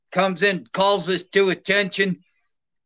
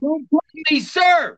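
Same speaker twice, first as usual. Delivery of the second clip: drill sergeant shout; 8 semitones higher than usual. Retold or imitated. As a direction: snap it at the new recruit. Drill sergeant correcting recruit.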